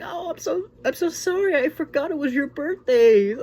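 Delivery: very high, loud gushing apology; mock sorry mom. Mom apologizing to daughter for forgetting birthday, high hammed-up. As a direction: gush an over-the-top apology like a flustered mom. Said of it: "The mom's like".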